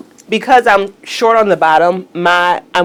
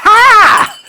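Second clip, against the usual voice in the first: delighted shout; 8 dB louder than usual. Real, not acted. Loud delighted laugh.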